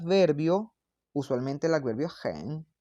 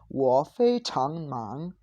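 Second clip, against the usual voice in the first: drawn-out, syllable-by-syllable Mandarin example; slow model pronunciation; much slower than usual. Shown as a demonstration. Teacher showing students "extremely busy" in Mandarin, slow model pronunciation. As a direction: model the Mandarin sentence very slowly.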